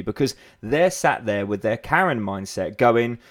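ordinary talk, nothing marked

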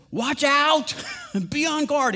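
high, loud cry of alarm; urgent warning yell. Retold or imitated. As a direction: shout it like there's a snake at your feet. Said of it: "That's what he's yelling".

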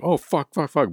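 high panicked swearing; panicked cursing. Retold or imitated. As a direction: curse in sudden panic. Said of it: "I was like".

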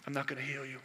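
very low, hushed, solemn voice; God. Retold or imitated. God answering a dying missionary's prayer, hushed and solemn. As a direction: drop right down, soft and grave, with long pauses. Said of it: "God said".

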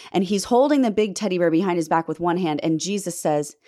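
ordinary talk

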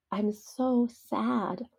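tearful prayer. Retold or imitated. Mother retelling her tearful prayer to the Lord, slow and tearful. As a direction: let the sadness tremble through, slowly. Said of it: "I wept tears and cried unto the Lord".

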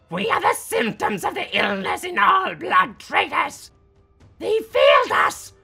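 very high, loud, ranting shriek; frenzied shout. A narrator's reading. Crowd ranting about blood traitors who failed them, frenzied shout. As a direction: build to a furious, shrieked accusation.

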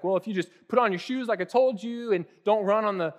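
exasperated parental lecture; lecturing parent. Retold or imitated. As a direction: lay out the cold hard facts to a toddler. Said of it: "Well, it's like".

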